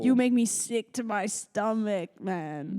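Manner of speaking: slow put-on Toronto accent; mock Toronto drawl, sinking at the end. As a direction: imitate Toronto slang, slowly. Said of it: "Toronto accents, hot take".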